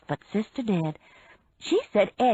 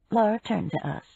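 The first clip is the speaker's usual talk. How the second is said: ordinary delivery, nothing marked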